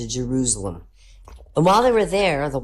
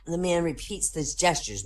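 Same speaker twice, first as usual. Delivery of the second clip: ordinary delivery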